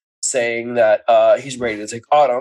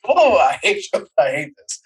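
whiny mock pout, slightly higher; pouting twelve-year-old; 3 semitones higher than usual. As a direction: sulk like a bratty kid. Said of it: "like a twelve-year-old, proceeds to pout".

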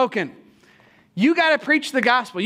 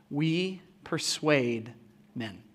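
slow, lower and much softer, each word weighed; slow emphatic scripture; 8 semitones lower, 10 dB quieter, much slower than usual. Acted. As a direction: draw it out quietly for weight. Preaching quoted scripture.

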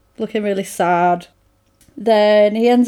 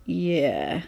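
mock sheepish, much lower and softer; sheepish admission; 6 semitones lower, 10 dB quieter than usual. Boyfriend caught about the flowers, sheepish low quiet admission. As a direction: caught out, mumble it small and low. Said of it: "And he's like".

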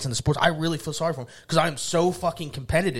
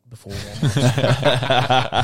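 laughing; 8 dB louder, much slower than usual. Real, not acted. Laughing while telling story.

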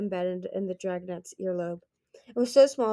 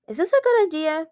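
high, louder anxious question; 9 semitones higher, 7 dB louder than usual. A narrator's reading. Moon doubting Darkstalker's magic on the bracelet, anxious. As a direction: pitch it up with worry. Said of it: "Moon said anxiously".

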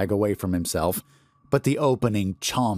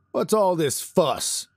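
higher, slow, put-upon guard voice; gruff guard; 9 semitones higher than usual. Acted. Guard calls from the dark hallway, annoyed. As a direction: drawl it like an irritated guard.

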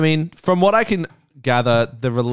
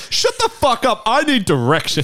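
mock angry dad; 11 semitones higher than usual. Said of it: "It's just like".

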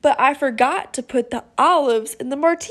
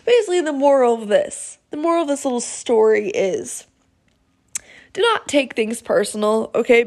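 ordinary delivery

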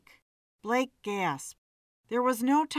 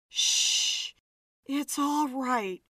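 hushing; 4 semitones higher, slower than usual. Acted. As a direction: hush him gently, slowly. Hushing a panicked man.

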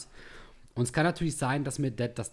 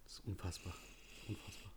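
very quiet, stunned aside; muttered disbelief; 4 semitones lower, 19 dB quieter than usual. Real, not acted. Co-host reacts to the smelly perfume sample, muttered disbelief. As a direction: say it under your breath in disbelief.

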